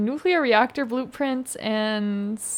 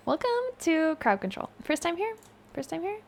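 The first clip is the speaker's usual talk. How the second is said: high, soft little greeter voice; hushed, raised-pitch welcome; put-on welcome; 6 semitones higher, 6 dB quieter than usual. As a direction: greet them mockingly small and high.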